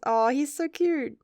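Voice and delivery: normal talking voice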